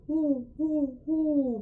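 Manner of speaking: high hooting owl oohs. Shown as a demonstration. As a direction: hoot like an owl. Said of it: "on a big owl hoot".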